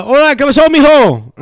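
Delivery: imitating coworker, high and loud. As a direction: greet him like a chummy older Mexican man. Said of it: "So they're like".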